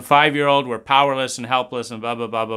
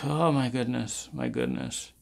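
fading exasperation; 9 dB quieter than usual. Acted.

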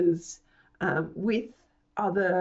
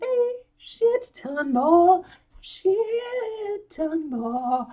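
playful exaggeration; 11 semitones higher, 3 dB louder, much slower than usual. Real, not acted. Playful emphasis on more content.

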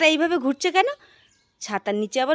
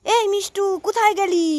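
high call, pitched up; calling louder; 6 semitones higher than usual. Acted. Mother searching the yard, calling out for the children. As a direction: shout for the hidden kids. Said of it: "আরও জোরে জোরে ডাকতে হবে।".

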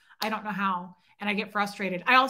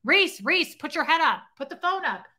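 pretend nagging friend; 6 semitones higher, 5 dB louder than usual. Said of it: "one of you needs to go".

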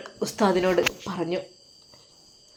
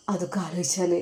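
whisper; 2 semitones lower, 7 dB quieter than usual. Acted. Weeping teacher answers his friend.

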